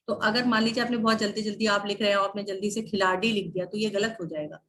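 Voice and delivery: ordinary talk, nothing marked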